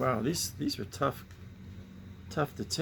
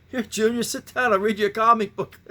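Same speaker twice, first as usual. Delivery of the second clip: higher, louder mock-cheerful parent voice; imitating a parent; 8 semitones higher, 7 dB louder than usual. Mock parent coaxing little Junior to sit for a comic. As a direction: play the doting dad inviting his kid to story time. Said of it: "I can't picture, like".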